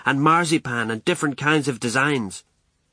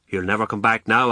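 usual speaking voice

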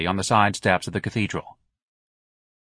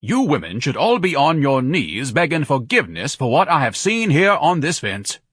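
higher, louder, slowing indignant rebuke; parting tirade; 5 semitones higher, 4 dB louder than usual. A narrator's reading. Ignatius leaves the ladies with a rebuke, indignant. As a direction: condemn them as you leave.

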